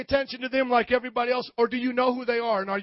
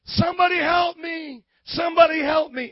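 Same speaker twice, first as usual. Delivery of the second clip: high, raised mock cry for help; imitated scream for help; 8 semitones higher, 6 dB louder than usual. Pastor imitating homeless man on the street, screamed plea. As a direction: call out as the desperate man did. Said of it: "he just screamed out".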